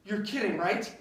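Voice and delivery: ordinary delivery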